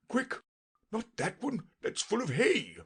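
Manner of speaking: high, hurried little king voice; a flustered king, pitched high; fussy king. Acted. King voiced in read story.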